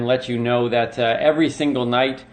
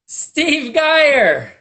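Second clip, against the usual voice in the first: much higher and louder, like a ring announcer; announcer shout; 13 semitones higher, 5 dB louder than usual. Acted. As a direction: call the guest out with a big hype. Host announces guest arriving.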